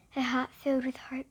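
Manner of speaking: hushed hopeful whisper. Acted. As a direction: say it under your breath. Whispered narration.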